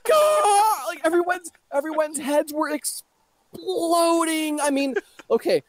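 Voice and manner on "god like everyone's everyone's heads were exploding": high, hammed-up shocked wailing; mock shock. Friend retelling the community's reaction, hammed-up shocked wail. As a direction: play the crowd losing their minds, half crying.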